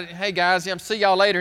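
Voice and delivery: loud, offhand goodbye; acted breezy exit line; casual brush-off. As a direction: excuse yourself to slip away from friends. Peter excusing himself from the Gentiles.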